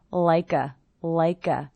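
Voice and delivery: "like a" with the K gliding into the schwa; linked K. Shown as a demonstration. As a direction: join "like a" as "lika". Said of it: "the K linking to the schwa".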